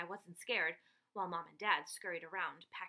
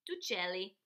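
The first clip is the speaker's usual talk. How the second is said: louder, lightly chirpy bird announcer; twittering bird; 5 semitones higher, 7 dB louder than usual. Acted. Girl in bird mask introducing parents, light twittering. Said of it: "I twittered".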